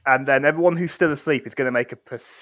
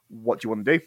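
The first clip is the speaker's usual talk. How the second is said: ordinary talk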